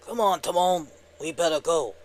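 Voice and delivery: goofy cartoon sidekick voice; Pumbaa. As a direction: nudge your buddy to leave in a cartoon voice. Skit voicing Pumbaa.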